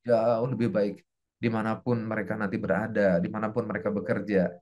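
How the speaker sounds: low, hushed supplication; subdued, low blessing; teacher's prayer. Shown as a demonstration. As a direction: pray earnestly under your breath for your pupils. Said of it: "I pray for you".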